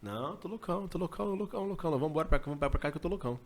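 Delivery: high and urgent, repeating loucão; excited. Retold or imitated. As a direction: blurt it out wound up and agitated. Agitado dizendo que está louco. (agitado, repetindo loucão)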